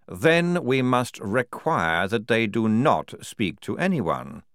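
slow, emphatic ruling; commanding general. Acted. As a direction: lay down the order slowly. General issues an order.

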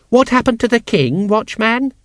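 put-on high, loud child's question; small child. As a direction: demand an answer like a nosy child.

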